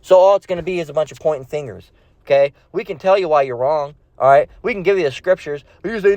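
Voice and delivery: normal talking voice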